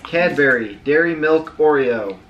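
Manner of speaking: loud, thrilled product announce; excited announcement. Real, not acted. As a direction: shout the name with delight. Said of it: "You're excited about this one".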